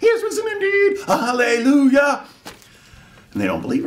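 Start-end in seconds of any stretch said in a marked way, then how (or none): 0.0-2.2 high, loud, bursting with joy; overjoyed announcement. Acted. Mary bursting in to tell the scared disciples, joyful cry. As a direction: shout the good news, overjoyed. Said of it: "And she goes".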